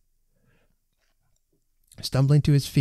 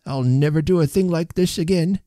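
put-on higher voice of a contrite old man; lightly voiced elderly whimper; 6 semitones higher than usual. A narrator's reading. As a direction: promise it meekly, pitched up. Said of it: "whimpered Mister Timmins".

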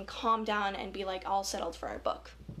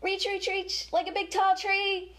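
rising reaching cue; 7 semitones higher than usual. Shown as a demonstration. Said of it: "let's do it one more time".

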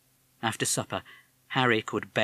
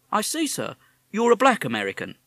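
policeman; 11 semitones higher, 6 dB louder than usual. Acted. Policeman replies.

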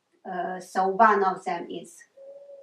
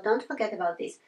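ordinary delivery

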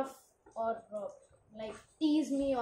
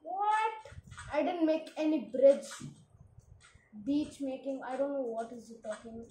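ordinary delivery

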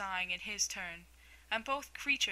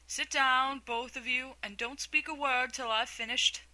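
loud bossy order, voice raised; commanding; 4 semitones higher, 5 dB louder than usual. A narrator's reading. Mock Turtle ordering Alice and Gryphon to sit, loud command.

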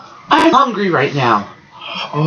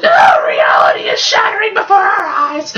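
melodramatic shout; 12 semitones higher than usual. Acted. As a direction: proclaim it dramatically. Melodramatic outburst.

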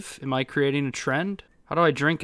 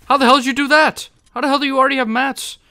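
fast, loud, high-pitched disbelief; incredulous outburst; 10 semitones higher, 7 dB louder, faster than usual. Real, not acted. Gamer amazed at an opponent's move, incredulous outburst. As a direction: fire off astonished questions quickly.

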